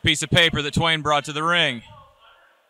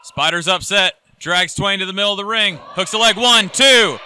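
excited ringside play-by-play; wrestling commentator; 6 semitones higher, 3 dB louder than usual. Acted. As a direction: call the near fall live. Live play-by-play of the match.

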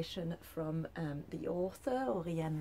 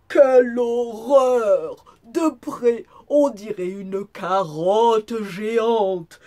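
wolf's loud, slow, drawn-out outcry; hammed-up booming dismay; horrified exclamation; 5 semitones higher, 20 dB louder, slower than usual. A narrator's reading. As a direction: exclaim in theatrical horror, stretching key words. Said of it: "et s'exclama".

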